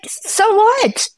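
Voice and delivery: high, dismissive so what; mock scoff. Friend praises husband's laundry, she scoffs high. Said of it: "I'm like".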